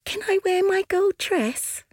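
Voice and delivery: put-on very high eager girlish voice; squeaky excited little sister; warm beaming sun voice. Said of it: "asked the sun".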